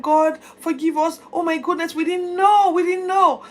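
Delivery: loud, high pleading to God; crying out. Acted. Josiah at the temple crying to God, loud. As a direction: beg forgiveness, close to tears. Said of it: "and he's crying".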